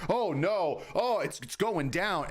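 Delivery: high, slightly slowed whiny fret; mock panic. Mocking traders who turn bearish when the dip comes with a high whine. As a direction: play a nervous trader watching price fall.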